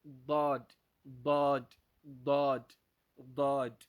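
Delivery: loud, punched "ضاد" with a heavy emphatic D; drilling emphatic "ضاد"; Arabic Daad demo. Shown as a demonstration. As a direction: model each "ضاد" separately, tongue sides on teeth. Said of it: "هذا هكذا ينطق بالضاد".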